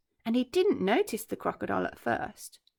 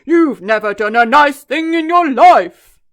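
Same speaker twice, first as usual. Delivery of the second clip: much louder, slow elephant voice; booming scold; 5 semitones higher, 18 dB louder than usual. Acted. Trunky scolding the crocodile, slow and booming. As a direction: scold him heavily.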